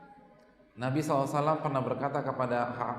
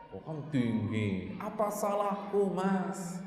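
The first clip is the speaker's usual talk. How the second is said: mock sulky wife; 3 semitones higher, 4 dB quieter, slower than usual.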